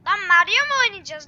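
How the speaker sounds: loud, startled outcry; surprised shout. Real, not acted. Gamer to viewers at a surprise Mario level, loud surprised outcry. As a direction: blurt it out in shock.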